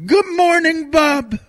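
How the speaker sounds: put-on very high parrot squawk with a Jamaican accent. Imitating [name]'s parrot, very high Jamaican accent. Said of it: "Did he have a Jamaican accent?".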